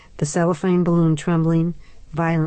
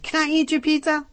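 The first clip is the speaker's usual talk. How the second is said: put-on child voice; 11 semitones higher than usual. A narrator's reading. Adam asks hopefully.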